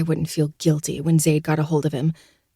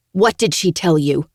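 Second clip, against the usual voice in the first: demanding; 3 dB louder than usual. Acted. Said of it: "she demands".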